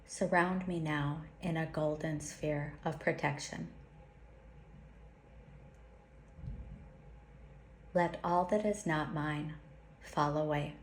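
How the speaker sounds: slow, solemn prayer with long pauses; slow invocation. Acted. Teacher leads listeners through a protection invocation, slowly and solemnly. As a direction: intone each line like a sacred vow. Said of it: "I'll say it slowly".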